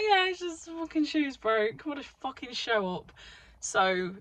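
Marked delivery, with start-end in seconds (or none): 0.0-3.1 exasperated. Retold or imitated. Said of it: "I was like".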